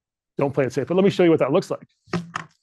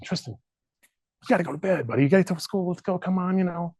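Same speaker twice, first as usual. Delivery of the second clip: urging son to bed; 5 semitones higher than usual. Retold or imitated. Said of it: "my son's name is Tristan".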